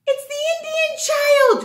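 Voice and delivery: high, loud delighted announcement; excited. Acted. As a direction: announce it like a treat.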